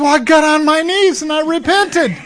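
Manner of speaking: high, laughing admission. Retold or imitated. Mafia man admitting to his counselor he repented, laughing. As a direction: laugh through it, sheepish.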